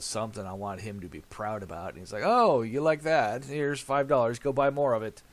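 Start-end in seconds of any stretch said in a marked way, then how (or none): 2.2-5.2 louder, slowed imitation of a dismissive father; mock dismissive dad. Son imitating father's dismissive reply. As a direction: sneer it like a dad brushing off his son.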